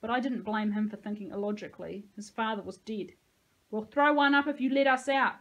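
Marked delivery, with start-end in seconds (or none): 3.7-5.4 loud, higher bargaining yell; shouted. A narrator's reading. Narrator bargains with the boy above, shouted. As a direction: call the offer up out of a pit. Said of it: "I shouted".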